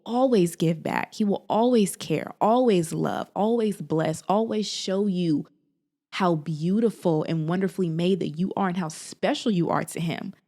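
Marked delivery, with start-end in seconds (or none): none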